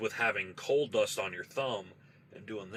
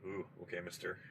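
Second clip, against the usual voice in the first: much softer, a little lower "Ooh, okay, mister"; awkward hushed reaction; 3 semitones lower, 12 dB quieter, faster than usual. Retold or imitated. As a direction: back off uneasily under your breath. Awkward reaction to creepy answer.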